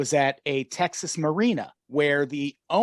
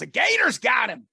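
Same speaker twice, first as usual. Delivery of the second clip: high-pitched mock cry of alarm; acted-out outcry, pitched way up; retold alarmed cry; 9 semitones higher, 3 dB louder than usual. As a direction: call it out like a startled bystander. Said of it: "the other woman goes".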